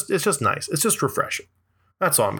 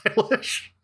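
imitating Nancy Pelosi; 8 semitones higher, slower than usual. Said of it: "Nancy Pelosi being like".

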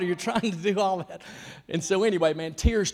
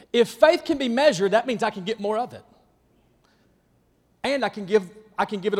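preaching; 5 semitones higher, 7 dB louder than usual. Acted. Preaching at full force.

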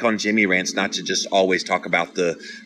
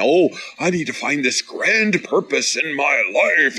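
high, swooping, overblown quote; mock grandiose; 7 semitones higher than usual. Mimicking people who seek a grand purpose, mock grandiose. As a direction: declaim it with exaggerated drama.

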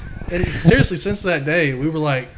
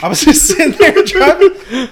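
words squeezed out through a high laugh; laughing hard while telling it; 12 semitones higher, 9 dB louder than usual. Real, not acted. Laughing while retelling glasses break.

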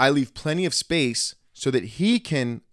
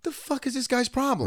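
retold pissed-off thought; 13 semitones higher than usual. Recounting his reaction to rude driver, exasperated. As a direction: relive being pissed off in a high voice. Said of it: "But now I'm pissed".

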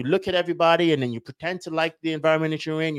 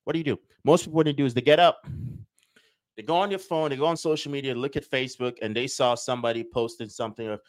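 ordinary delivery

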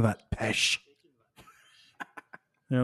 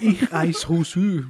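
fake German accent; 5 semitones higher, 5 dB louder, slower than usual. Answering in mock German.

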